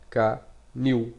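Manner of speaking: Canil with the last syllable nil held strong; Canil, lean on the final syllable; stress on last syllable. Shown as a demonstration. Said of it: "la plus forte". (Canil, dernière syllabe forte)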